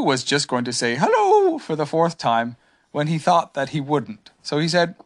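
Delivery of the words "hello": small gentle bear; Pooh voice. A narrator's reading.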